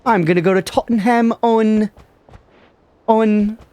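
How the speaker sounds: exaggerated British caricature; mock British accent. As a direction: ham up an amateur British accent. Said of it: "My British accent".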